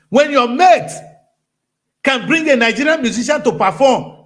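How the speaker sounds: loud, high preacher's tirade; fiery preaching. Acted. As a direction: scold the crowd like a pulpit preacher. Preaching rant at full force.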